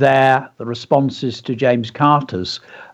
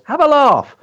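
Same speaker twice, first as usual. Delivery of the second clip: high, exclaimed LAUGH phrase; performance-poem punch; emphatic dragon line; 13 semitones higher, 4 dB louder than usual. Shown as a demonstration. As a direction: call out "Have a laugh" with a big swooping pitch. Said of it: "So the emphasis is on".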